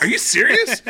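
amused incredulous shout; laughing disbelief. Retold or imitated. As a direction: crack up in amazement. Reacting to rich star's confession.

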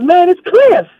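very high put-on voice; mock Cliff voice. Cliff announcing himself to the caller, very high mock voice. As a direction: pitch way up to play Cliff. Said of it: "And he goes".

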